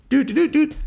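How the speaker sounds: high sung-out dialing beeps; phone dialing beeps. Retold or imitated. Playboy calling up a girl, high imitated beeps. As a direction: imitate keypad tones in a high pitch.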